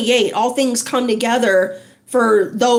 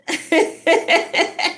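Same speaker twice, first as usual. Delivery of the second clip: laugh; 5 semitones higher than usual. Real, not acted. Laughing at own joke.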